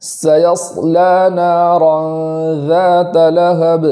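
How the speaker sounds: slow melodic recitation of the whole verse; tajweed recital; Quran recitation. Shown as a demonstration. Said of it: "پوری آیت اس طریقے سے".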